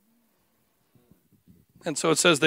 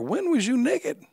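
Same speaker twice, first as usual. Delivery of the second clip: higher, slow, baffled Peter; puzzled higher Peter voice; 8 semitones higher than usual. Retold or imitated. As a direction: ask it like a bewildered disciple. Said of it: "I can almost hear Peter".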